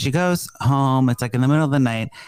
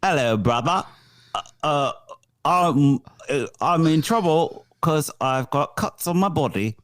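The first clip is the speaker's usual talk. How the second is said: put-on British accent. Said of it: "picking up a call British or whatever accent".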